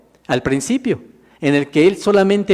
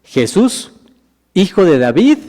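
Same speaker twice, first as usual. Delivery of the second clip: mildly raised, higher calling voice; 3 semitones higher, 4 dB louder than usual. Acted. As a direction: call out to someone passing, without a full shout. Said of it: "comenzó a dar voces y decir".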